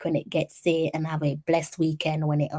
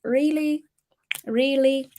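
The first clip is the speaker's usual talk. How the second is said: mock disbelief; 8 semitones higher, slower than usual. Teasing viewers who can't come.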